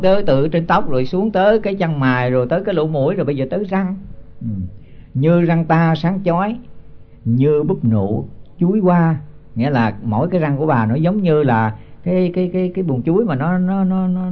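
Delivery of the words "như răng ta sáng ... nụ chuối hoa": slow, measured chant of the verse; slow scripture chant before explaining it; slow verse recitation. Acted. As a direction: intone each syllable like scripture. Monk recites verse line slowly.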